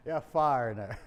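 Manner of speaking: put-on the boy's verdict laughed out; laughing through the quote; laughing repeat. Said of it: "He says".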